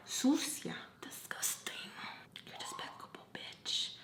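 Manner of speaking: contemptuous whisper; whispered disgust. Retold or imitated. Her face insulting the friend, whispered disgust. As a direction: whisper the insults under your breath.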